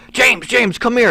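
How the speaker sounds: high mock call across the room. Boss calls the wrestler over, high calling voice. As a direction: imitate the boss calling your name down the stairs.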